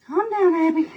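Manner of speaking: high soothing coo to the dog; calm the dog in a high coo; high pet voice. Real, not acted.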